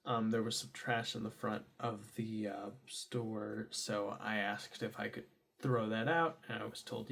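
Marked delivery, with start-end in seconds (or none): none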